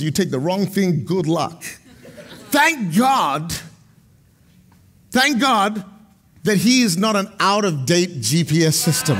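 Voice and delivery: ordinary talk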